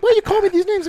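put-on high, whiny child's voice, well up in pitch; hurt kid voice. A boy upset at being called names, in a high whiny voice. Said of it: "He's like".

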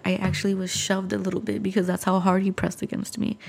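normal talking voice

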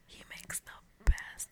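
breathy ASMR whisper, slowing at the end. Shown as a demonstration. Still in ASMR mode, naming her favourite channel in a whisper. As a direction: keep the close-mic ASMR hush going.

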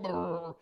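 loud rolling "brrr"; trilled quake noise; imitated earthquake rumble. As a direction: rumble like shaking ground.

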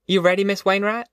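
high-pitched call, rising at the end. A narrator's reading. Ma checking on Miss Wainwright, calling out high. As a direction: call over to a neighbour, pitched well up. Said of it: "She called".

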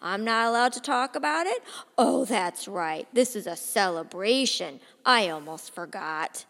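loud sarcastic defiance. Acted. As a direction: push back harder at the father. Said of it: "only makes Marcus get louder".

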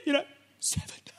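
fast, hushed whisper. Retold or imitated. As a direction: whisper it like a delicious secret. Secret aside about rental.

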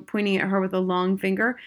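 ordinary delivery

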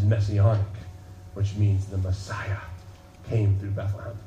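low, soft sermon close; reflective preaching. Acted. Preacher closing the message softly over music. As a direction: draw them in quietly.

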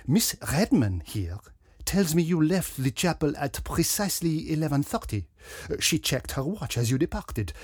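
put-on French-accented sleuth building his case; French detective, accusing. A narrator's reading. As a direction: corner the suspect with a pompous French accent. Detective questions the Reverend.

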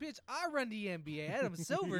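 loud, high, hammed-up indignation; mock outrage. Imagined LeBron telling off the league boss, mock outrage. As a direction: play an outraged star pulling rank. Voicing LeBron confronting Adam Silver.

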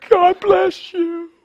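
high, slow, teary thanks; imitating tearful relief. Preacher imitating the woman's tearful thanks, high and slow. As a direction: voice her crying relief.

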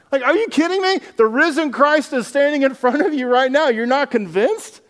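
preacher mocking the doubters' disbelief; incredulous disbelief. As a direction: throw up your hands in disbelief.

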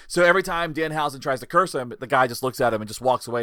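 ordinary talk, nothing marked

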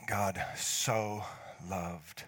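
God so loved, very slow, "so" stretched out; stress on so. Shown as a demonstration. Pastor savouring the word "so" for the congregation, slow and deliberate. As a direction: linger on "so" and let each word land. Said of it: "I just love the word "so".

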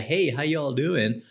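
slow, drawn-out fake American accent; mock a returnee's newly acquired American accent; put-on American accent. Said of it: "then come back with the".

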